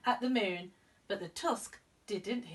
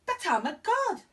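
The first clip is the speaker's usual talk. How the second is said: higher, proud voice; boastful young god; 9 semitones higher, 5 dB louder than usual. Acted. Ganesh boasting to Mister Mouse, a higher proud voice. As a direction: brag like a cocky kid.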